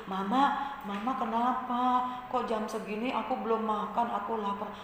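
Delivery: put-on soft, hushed child asking mama; meek, tender little plea; gentle child. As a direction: ask mama gently, very quietly. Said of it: "anak yang lemah lembut itu".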